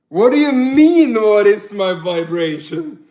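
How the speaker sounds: incredulous. Real, not acted. Baffled by a chat question.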